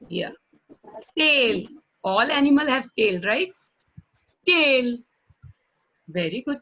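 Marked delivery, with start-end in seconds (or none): 1.2-1.7 Tail said loud and clear, falling; model pronunciation. Shown as a demonstration. Teacher models tail for children, loud and clear. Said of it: "You repeated the word in your mind". 4.5-5.0 Tail said loud and clear, falling; model pronunciation. Shown as a demonstration. Teacher models tail for children, loud and clear. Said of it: "You repeated the word in your mind".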